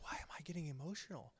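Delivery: hushed, voiceless aside to himself; whispered self-question. Retold or imitated. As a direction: whisper it to yourself. Asking himself during a tearful film.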